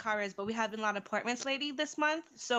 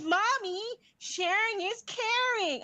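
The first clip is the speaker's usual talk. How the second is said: put-on high, slow sing-song little girl lecturing mom; mock-innocent kid pleading to share; child voice; 12 semitones higher, much slower than usual. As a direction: drawl "sharing is caring" slowly and high like a small child. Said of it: "She goes".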